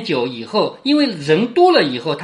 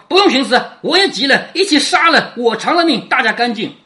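higher, louder, mildly furious reading; narrated angry outburst; 6 semitones higher, 4 dB louder than usual. A narrator's reading. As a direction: read it as a heated threat, voice raised. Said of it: "贾琏气得从墙上拔出剑来说".

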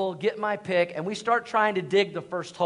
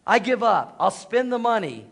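louder, higher exasperated surrender; giving up; 5 semitones higher, 7 dB louder than usual. Retold or imitated. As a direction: throw up your hands, worn out and done. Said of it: "I'm like".

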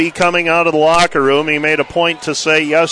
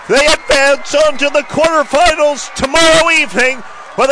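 excited play-by-play; 10 semitones higher than usual. Real, not acted. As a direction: scream the upset win home. Winning point call.

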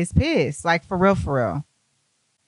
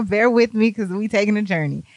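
ordinary talk

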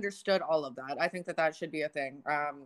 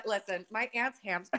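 ordinary talk

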